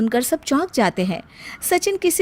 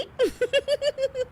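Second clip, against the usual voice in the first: high, light self-pleased giggle; 14 semitones higher, 8 dB quieter than usual. Acted. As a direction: giggle at your own cleverness. Hina pleased with her rhymes.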